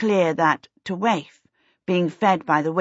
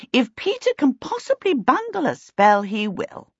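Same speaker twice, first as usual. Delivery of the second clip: high, emphatic assertion, dropping at the end; firm, raised-pitch pronouncement; assertive; 8 semitones higher than usual. A narrator's reading. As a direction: state it as certain, pitched up. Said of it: "she asserted".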